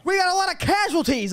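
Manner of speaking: high-pitched battlefield report, hammed up; mock movie soldier. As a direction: parody urgent military radio talk. Mocking military movie dialogue.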